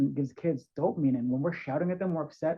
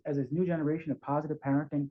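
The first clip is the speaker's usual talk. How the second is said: ordinary delivery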